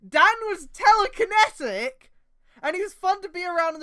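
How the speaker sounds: overacted glee at the twist; mock excited. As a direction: blurt it out like a grand reveal. Mock excited fanfic reveal.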